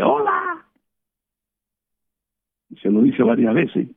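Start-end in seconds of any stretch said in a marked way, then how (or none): 0.0-0.7 high sing-song hello; talking to a parrot. Retold or imitated. Preacher greeting a parrot, high cooing voice. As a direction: coo it up high like greeting a bird. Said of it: "A los loros tú dices".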